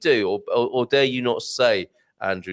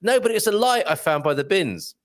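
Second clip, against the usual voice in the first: normal talking voice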